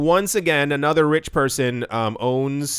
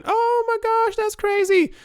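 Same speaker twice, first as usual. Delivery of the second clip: much higher, squeaky fake shock; mock amazement; slower than usual. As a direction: gasp it out in mock disbelief.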